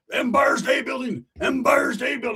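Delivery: high, slow shouted landmark names; excited shout. Acted. Bragging about getting high, shouting. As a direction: belt it out like a bragging stoner.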